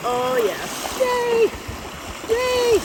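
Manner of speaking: high, squealing cheers of joy; excited. Real, not acted. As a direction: squeal yay with delight. Delighted at finding an agate.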